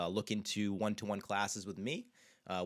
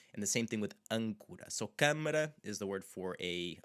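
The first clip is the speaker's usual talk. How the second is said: ordinary talk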